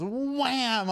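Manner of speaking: ordinary talk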